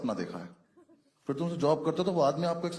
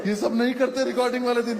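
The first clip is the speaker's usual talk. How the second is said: higher and louder, laughing through it; laughing complaint; 8 semitones higher, 6 dB louder than usual. Real, not acted. Comedian laughing at the audience, loud and laughing. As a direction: break up laughing at the crowd.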